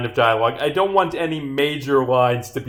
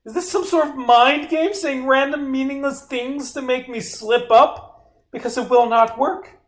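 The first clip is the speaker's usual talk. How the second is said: high, raised, offended tirade; indignant outburst; 11 semitones higher than usual. Acted. Witness accusing detective of mind games, indignant. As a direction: protest in a much higher voice. Witness outraged at wrong evidence.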